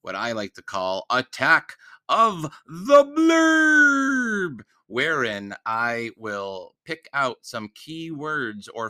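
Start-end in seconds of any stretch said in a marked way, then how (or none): 1.1-4.6 high, slow, booming trailer voice; dramatic announcer. Acted. Host introducing his segment, hammed-up trailer announcer. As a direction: sell the segment title like a movie trailer.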